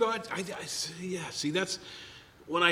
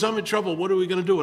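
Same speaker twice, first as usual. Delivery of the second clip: higher and louder, frantic friend; mock panic; 6 semitones higher, 6 dB louder, faster than usual. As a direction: plead like a man in a crisis.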